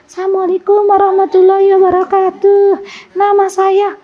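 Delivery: high, thin voice of a shy woman asking; timid small voice. Retold or imitated. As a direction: imitate the asker whose voice barely carries. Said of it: "memang volumenya kecil". (suara ibu jamaah yang kecil dan malu-malu, nadanya tinggi)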